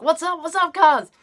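high, eager asking of the cousins; concerned cousins. Retold or imitated. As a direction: press her to spill it.

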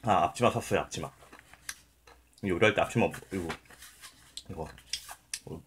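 talking with food in mouth; speaking between chews; chewing while talking. Real, not acted. Seen 2: Bite taken at -4; closed, bulging, working mouth between phrases.

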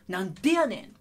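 loud Kansai retort with a pitch swing; punchy intonation of a Kansai comeback; Kansai dialect. Shown as a demonstration. Teacher shows students Japanese dialect with intonation, Kansai accent. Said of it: "関西弁は若干ね".

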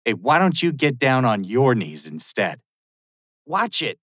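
ordinary talk, nothing marked

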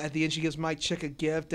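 usual speaking voice

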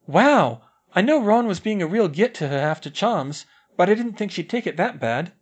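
a schoolgirl surprised at the news. A narrator's reading. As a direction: gasp it out as a schoolgirl.

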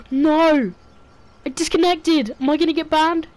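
high, loud alarmed cry; panicked. Real, not acted. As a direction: panic at the disconnect. Game disconnects.

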